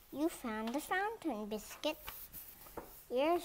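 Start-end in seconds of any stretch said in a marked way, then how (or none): none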